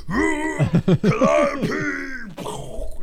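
high, loud cry of the spell name; mock spell cry. Magician player yells his spell at the enemy, high. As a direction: call out the spell like a wizard.

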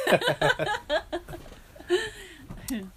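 big high burst of laughter; loud amused cackle; laughing. Real, not acted. Laughs at co-host's lack of enthusiasm.

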